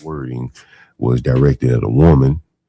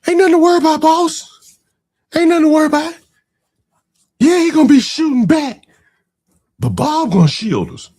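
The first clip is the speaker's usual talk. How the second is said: much higher, squeaky mocking voice for the sidekick's pep talk; mock hype-man pep talk; 4 dB louder than usual. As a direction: ham up a toady cheering on his boss, pitched way up. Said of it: "give him a pep talk".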